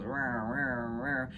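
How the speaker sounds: low rolling "rrr" for a wobbling pupil; playful low rrr mimicking a flicker; imitated wavering growl. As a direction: buzz it out low like something shaky.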